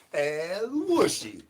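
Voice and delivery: crooning call in a strange voice; high, slow put-on voice. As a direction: stretch El Wushi out slowly and high. Repeated character chant.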